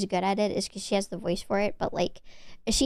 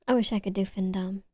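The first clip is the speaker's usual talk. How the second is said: ordinary talk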